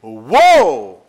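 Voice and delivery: loud, drawn-out cry of woe; a loud lament; anguished cry. Acted. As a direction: wail the word as a groan of despair.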